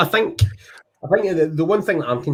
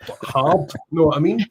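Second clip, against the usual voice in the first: ordinary talk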